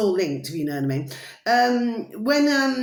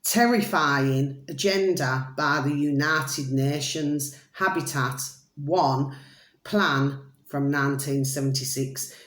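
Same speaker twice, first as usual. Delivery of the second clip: each word drawn out slowly; slow dramatic reading; 6 semitones lower, slower than usual. Shown as a demonstration. Vlogger reading a video title to followers, slow and grave. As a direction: read the title as if it were a grim verdict. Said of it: "I'm just going to repeat that again".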